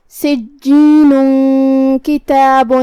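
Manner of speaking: سجین کتاب with the tanween hidden in a nasal hum before ک; slow recited سجین کتاب, nasalised join; ikhfa with ghunna. Shown as a demonstration. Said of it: "چھپاتے ہوئے لیکن غنہ کے ساتھ".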